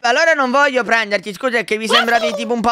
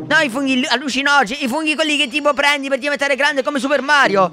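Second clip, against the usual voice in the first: ordinary talk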